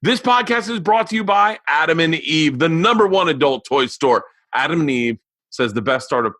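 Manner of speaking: high, punchy announcer delivery; sponsor ad read. Real, not acted. Host opening the sponsor spot to listeners, punchy ad read. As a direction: pitch the sponsor with energy.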